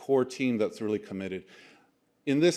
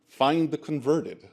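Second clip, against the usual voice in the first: ordinary talk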